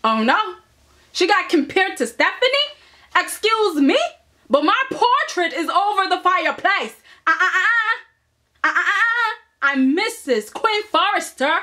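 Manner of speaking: high, huffy put-on diva voice; mocking Quinn. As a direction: play the offended wife, way up high. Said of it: "Quinn's face went".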